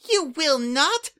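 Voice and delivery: high, loud, slow cry of a fierce little changeling; protective outburst. Acted. Young changeling shielding her friend from the spy, high fierce cry. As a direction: leap in front and shout the threat down.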